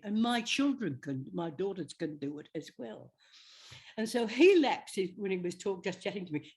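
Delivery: usual speaking voice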